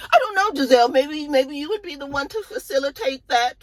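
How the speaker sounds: very high, sugary suggestion; a high, syrupy hint; sweetly scheming Karen. Retold or imitated. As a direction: coax Giselle into it. Karen sweetly nudging Giselle.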